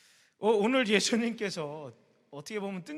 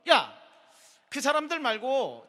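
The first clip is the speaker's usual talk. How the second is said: sharp loud call then higher; Jesus to disciples; 5 semitones higher, 5 dB louder, slower than usual. Retold or imitated. Jesus turning to his disciples, calling out sharply. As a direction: snap their attention back. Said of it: "제자들에게 이러는 거야".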